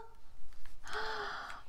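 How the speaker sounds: high breathless gasp of surprise; startled intake of breath; sharp gasp. Real, not acted. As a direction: suck in air, amazed. Sees the two dolphins.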